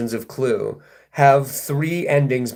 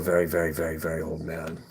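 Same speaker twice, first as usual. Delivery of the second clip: put-on very old man; 9 semitones lower, 9 dB quieter, faster than usual. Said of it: "I'm good at being old".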